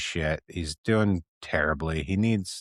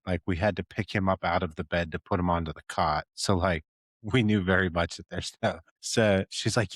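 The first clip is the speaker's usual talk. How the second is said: usual speaking voice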